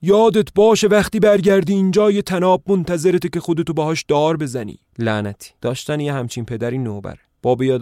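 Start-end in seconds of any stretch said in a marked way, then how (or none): 0.0-4.8 roared, much higher and louder; father roaring a curse after his runaway son. Acted. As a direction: bellow a curse at the fleeing son. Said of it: "سرم نعره کشید".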